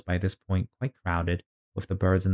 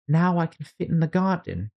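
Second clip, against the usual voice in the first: Alice voice; 9 semitones higher than usual. Acted. Alice's voice, high pitched.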